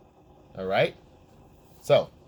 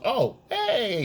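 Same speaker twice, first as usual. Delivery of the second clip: high, breezy greeting; airy high oh hey; girlfriend voice; 10 semitones higher than usual. Retold or imitated. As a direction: sing it out lightly like she forgot. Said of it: "She goes".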